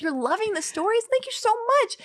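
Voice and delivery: giddy delight. Retold or imitated. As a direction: squeal thanks like a flattered fan. Thrilled reply to praise.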